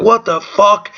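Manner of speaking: put-on high, puzzled child voice; kid's outburst. Little boy reacting to the chaos, high and bewildered. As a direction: blurt it like a confused little boy. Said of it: "Little boy's like".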